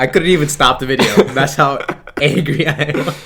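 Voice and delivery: laughing at his own frustration. Real, not acted. Cohost laughs about the video not stopping, amused. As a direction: laugh through the words.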